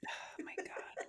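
breathless whisper; whispered disbelief. Real, not acted. Co-host whispering in disbelief about Kyle. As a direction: gasp it under your breath.

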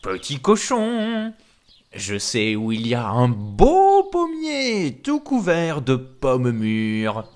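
slow, coaxing wolf voice; wily wolf. Acted. Wolf luring the pig to an apple tree, slow coaxing. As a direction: draw out the tempting words. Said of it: "et il dit seulement".

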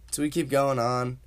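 ordinary delivery

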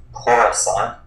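Coruscant as "KOR-uh-sahn", final t dropped; variant pronunciation. Shown as a demonstration. Co-host listing ways they've heard it, Coruscant as "KOR-uh-sahn". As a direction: say Coruscant with an "or" first and "ahn" last. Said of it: "pronounced so many different ways".

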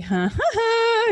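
slow high eerie huh huh huh; a spooky knowing chuckle; high drawn-out creepy laugh; creepy breathy laugh. Retold or imitated. Madame Geary creepy laugh.

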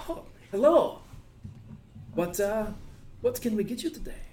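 high, bright-pitched shopkeeper greeting. Acted. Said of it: "and she goes".

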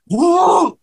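a high, whooping hello from a speeding car; excited whoop. Retold or imitated. Friend whoops on arriving in the Bug, high excited holler.

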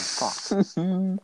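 high, sharp frustrated swear; real frustrated yelp; frustrated curse. Real, not acted. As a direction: blurt out a high yelp of annoyance. Missed hole in one in game.

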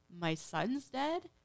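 high, drawn-out question, mildly acted disbelief; retold shocked question. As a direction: imitate a mother's stunned question, slowly. Retold mother learns son is dead.

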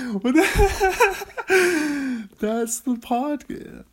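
high and loud, very slow through laughter; laughing. Real, not acted. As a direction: lose it laughing mid-line. Cracks up at own idea.

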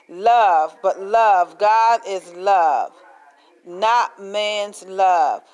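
love, God and not drawn out slowly, each hit hard; slow emphatic preaching. Real, not acted. Preacher to congregation on God's love, slow and emphatic. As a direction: lean on every word of it, slowly.

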